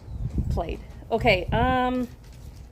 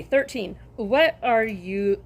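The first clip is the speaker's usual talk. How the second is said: ordinary talk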